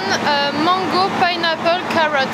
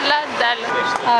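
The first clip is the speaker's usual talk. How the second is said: drawn out; 2 semitones lower, much slower than usual. Real, not acted. Faim avant les gaufres.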